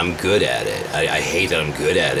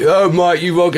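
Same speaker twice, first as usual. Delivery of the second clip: high, louder mocking troll voice; taunting troll; 13 semitones higher, 6 dB louder than usual. Quoting a game troll taunting him, high mock voice. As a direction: mimic the troll's sneer. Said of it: "There's one guy like".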